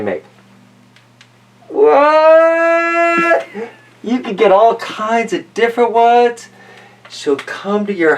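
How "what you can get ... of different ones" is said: high squeaky what; a shocked little yelp; surprised. Real, not acted. Vlogger seeing the masks, surprised high yelp.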